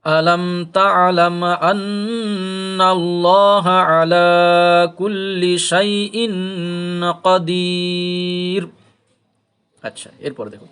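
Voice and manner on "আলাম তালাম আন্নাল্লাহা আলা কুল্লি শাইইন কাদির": slow melodic tilawat, louder and higher; Quran recitation. Acted. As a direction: chant the verse in a drawn-out tajweed melody. Recites the full verse. (ধীর সুরেলা তিলাওয়াত)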